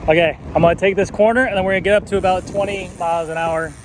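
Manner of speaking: talking into wind on a bike; riding outdoors in wind; wind noise. Real, not acted. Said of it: "Sorry for the wind noise".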